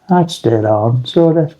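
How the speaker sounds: usual speaking voice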